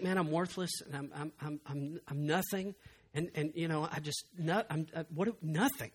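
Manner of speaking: soft, faltering self-pity; self-loathing mumble. Retold or imitated. Person who thinks little of themselves, mumbling softly. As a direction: trail off as if worthless. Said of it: "they just think".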